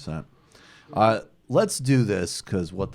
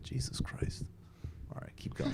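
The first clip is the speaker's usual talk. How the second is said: muttered aside; 13 dB quieter than usual. Real, not acted. Muttered aside over clip.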